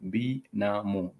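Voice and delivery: "binamu" slowly split as bi, na, mu; syllable by syllable. Shown as a demonstration. Teacher sounding out the word for cousin, syllable by syllable. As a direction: sound out "binamu" in three separate slow syllables.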